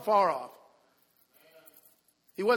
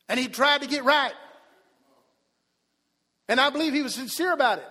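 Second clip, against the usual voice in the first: raised pulpit storytelling, higher and driving; impassioned preaching; 5 semitones higher, 10 dB louder than usual. Real, not acted. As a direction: proclaim each phrase to the congregation.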